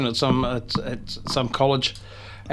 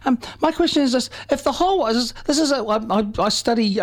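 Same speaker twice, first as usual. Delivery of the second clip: high, halting schoolgirl impression; little girl; 10 semitones higher than usual. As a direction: imitate a young girl stumbling through her question, pitched way up. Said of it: "And so she's like".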